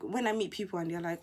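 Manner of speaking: normal talking voice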